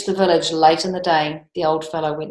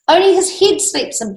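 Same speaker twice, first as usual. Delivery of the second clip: put-on boy's voice; 10 semitones higher, 3 dB louder than usual. A narrator's reading. Boy explaining to king.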